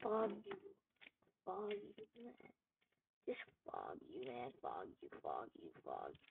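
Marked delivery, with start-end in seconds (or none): 1.4-6.2 grumbling it away under his breath; muttered fog you. Real, not acted. Gamer muttering censored curses at the game, fading low and soft.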